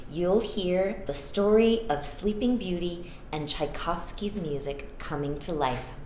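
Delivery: usual speaking voice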